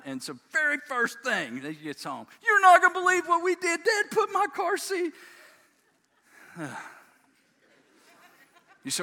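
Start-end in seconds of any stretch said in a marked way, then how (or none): 2.4-5.1 very high, loud mock-kid blurt; child shouting. Boy telling Mom the moment she's home, imitated excited shout. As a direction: yell it like a tattling little boy.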